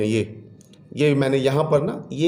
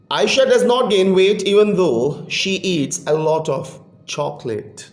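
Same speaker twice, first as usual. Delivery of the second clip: slow, higher, careful sentence reading; slow reading aloud; 5 semitones higher, much slower than usual. Shown as a demonstration. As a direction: read it clearly for students. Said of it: "ये ई आई". (बच्चों के लिए धीरे और साफ़ पढ़ना)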